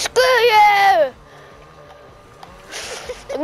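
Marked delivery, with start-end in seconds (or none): 0.0-1.1 angry yell. Acted. Yelling at Billy.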